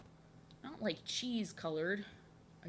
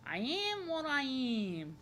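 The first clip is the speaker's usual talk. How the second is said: very slow, playfully stretched vowels; drawn-out; 3 semitones higher, much slower than usual. Pretend. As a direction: stretch each word out in playful self-acceptance. Playful drawn-out repeat of the line.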